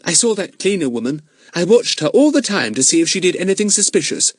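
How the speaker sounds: put-on high, restless girl's voice; a bored girl getting up to go. A narrator's reading. Eunice reporting.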